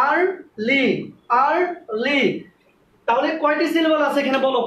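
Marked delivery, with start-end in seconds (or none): 0.1-0.8 early split slowly into আর and লি; drawn-out rising আর, then লি in early; broken into syllables. Shown as a demonstration. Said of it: "দেখো ভাঙতে হচ্ছে". 1.3-2.2 early split slowly into আর and লি; drawn-out rising আর, then লি in early; broken into syllables. Shown as a demonstration. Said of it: "দেখো ভাঙতে হচ্ছে".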